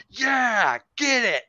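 high-pitched, drawn-out egging on; rousing cheer. Retold or imitated. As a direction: cheer the driver on, playfully hammed-up. Acting out cheering the driver on.

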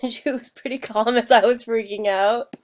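laughing. Real, not acted. Recalling the tornado with the cat, laughing. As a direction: laugh as you say it. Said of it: "I'm laughing now".

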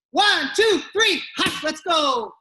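rally the class with a loud count. Real, not acted.

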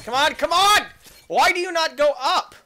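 loud, high plea at the game; exasperated gamer yell; frustrated yelling. Real, not acted. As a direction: raise your voice in exasperation.

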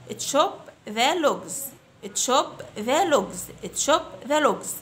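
put-on very slow, clear drill phrase for children to repeat; slow model phrase. Shown as a demonstration. Teacher to fifth graders, model phrase very slowly. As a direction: stretch each word out for the class. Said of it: "Repeat after me".

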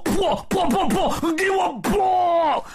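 high, excited volley of yells; battle shouting. A narrator's reading. Zhu Bajie rains rake blows, high excited battle shouts. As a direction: keep hammering out yells, the last stretched. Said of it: "嘴里一边大叫着".

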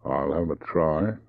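slow drawled reply; unhurried, measured answer; slow, deliberate. Acted. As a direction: take it slowly, word by word. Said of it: "He said slowly".

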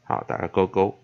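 ordinary talk, nothing marked

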